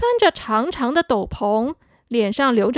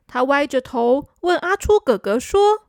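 usual speaking voice

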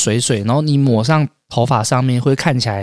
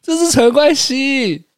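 insisting; 13 semitones higher, slower than usual. Pretend. Insisting loudly, drawn out.

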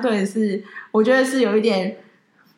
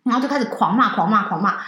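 ordinary talk

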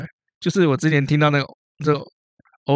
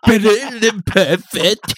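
higher, louder, very slow goofy voice; very slow goofy dopey voice; 6 semitones higher, 3 dB louder, much slower than usual. Shown as a demonstration. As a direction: play it dim and dopey. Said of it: "来个智障一点的".

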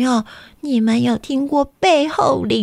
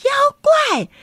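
very high startled squeal; storyteller reacting with mock shock to the monster; mock surprise; 9 semitones higher than usual. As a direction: act shocked and giggle.

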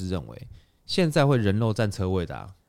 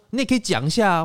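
much higher, louder mock auntie; the auntie demanding, pitched up; mimicking the auntie; 12 semitones higher, 6 dB louder than usual. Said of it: "他说". (高声模仿阿姨)

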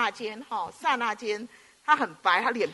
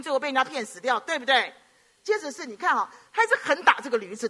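raised, emphatic pulpit cadence; preaching; 5 semitones higher than usual. Acted. Pastor warning the congregation about stubbornness, fervent preaching. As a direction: hammer each phrase home.

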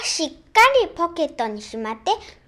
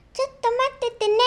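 kindly shopkeeper; 7 semitones higher, much faster than usual. Acted. Shopkeeper tells child to wait.

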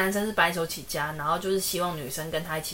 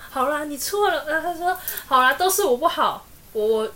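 mock panic; 7 semitones higher, 5 dB louder than usual. Panicked wife suddenly apologizing.

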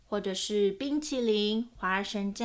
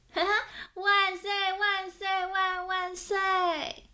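happy cheer; 10 semitones higher, slower than usual. Acted. Owen cheering over his new handkerchiefs, high and gleeful. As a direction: cheer like a thrilled little kid. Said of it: "阿文高兴地说".

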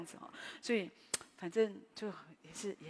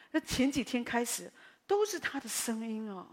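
ordinary talk, nothing marked

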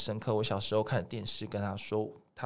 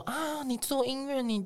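put-on high, fussy old grandma voice, voice raised way up; grandma's nagging; 11 semitones higher than usual. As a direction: warn like a worried grandma. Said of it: "像我阿妈就会说". (高八度模仿阿嬷叮咛)

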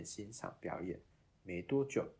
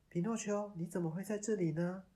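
fairy voice; 11 semitones higher, 3 dB louder than usual. Acted. Fairy asks Pinocchio.

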